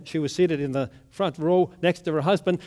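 ordinary talk

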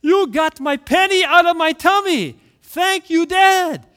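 high, loud, delighted child imitation; little boy. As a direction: exclaim it like an amazed small boy. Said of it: "little Billy says".

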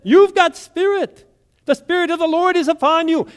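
very high, loud proclamation; fired-up Pentecostal pastor. Acted. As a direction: repeat the cry with rising revival fervor. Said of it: "he repeated what he said".

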